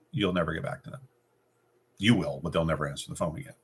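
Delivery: ordinary delivery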